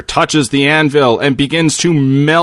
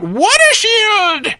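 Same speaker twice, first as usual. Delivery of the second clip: put-on high, drawn-out old man's scream; high-pitched old man yelling a spell; slower than usual. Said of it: "who screams out".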